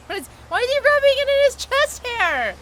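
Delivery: high, loud appalled shriek; loud grossed-out squeal; disgusted shriek. Real, not acted. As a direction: screech in horror.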